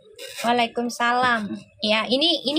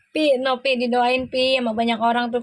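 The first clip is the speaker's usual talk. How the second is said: usual speaking voice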